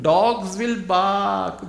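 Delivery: very slow, every word stretched; drawn-out proverb. Acted. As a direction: let the proverb land slowly.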